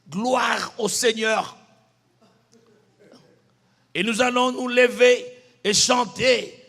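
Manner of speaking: loud, slow praise to the congregation; leading worship. Retold or imitated. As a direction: call the church to stand up. Plays himself leading worship.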